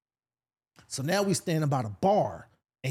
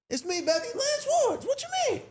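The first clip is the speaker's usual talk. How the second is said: much higher, bright fake confidence; squeaky bluff to win over a bouncer; overselling it; faster than usual. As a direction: pitch way up to sell it like an old friend. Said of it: "trying to sell it in my voice".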